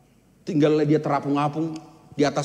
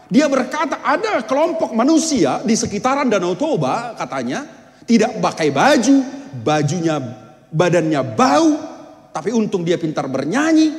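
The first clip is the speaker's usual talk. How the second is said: higher and louder, lively retelling; animated preaching; 8 semitones higher, 6 dB louder than usual. Real, not acted. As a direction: paint the scene with energy. Preacher retells explorer's report. (bercerita penuh semangat kepada jemaat)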